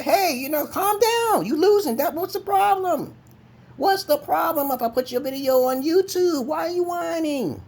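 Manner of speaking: very high, loud, drawn-out squeal of mockery; mock him in a stretched, high-pitched voice; high mocking taunt. Taunting the angry troll over a posted video, squealed high in mockery.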